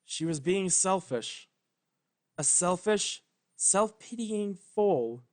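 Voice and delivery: usual speaking voice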